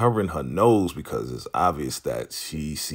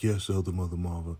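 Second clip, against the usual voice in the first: softer, subdued mock obedience; imitated meek compliance; 6 dB quieter than usual. Lacey obeying the order, imitated subdued reply. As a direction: answer softly, as if giving in.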